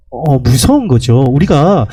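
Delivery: ordinary talk, nothing marked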